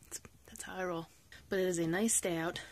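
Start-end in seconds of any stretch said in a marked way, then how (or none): 0.0-1.1 whispered joke. Real, not acted. Whispered joke about giving books.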